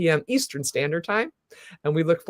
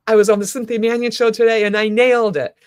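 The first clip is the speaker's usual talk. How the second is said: louder, quick pretend self-congratulation; proud self-talk; 3 semitones higher, 6 dB louder, faster than usual. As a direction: play a proud recap of your day, fast and loud. Models proud bedtime self-talk.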